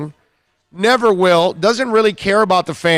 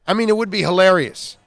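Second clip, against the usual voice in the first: ordinary delivery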